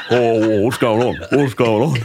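imitated bewildered referee; Jared Maxwell. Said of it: "and he's going".

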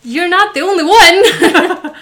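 loud, slow laughing yell; laughing shout. Real, not acted.

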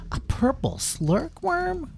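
shocked high cry. A narrator's reading. Townsfolk crying out in shock at the purple worm. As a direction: squeal it out in alarm.

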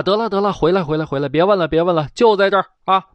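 hasty imperial call to come back; emperor calling back. Acted. Emperor calls the guard back.

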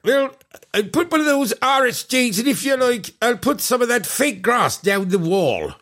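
high put-on Irish workman; chirpy Irish fitter offering extras; Irish accent. Irish fitter role continues.

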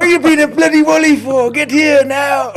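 high, loud, hammed-up teacher yelling at a pupil; mock teacher shout. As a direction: bark it out like an angry teacher, slowing at the end. Impersonating teacher Rod shouting.

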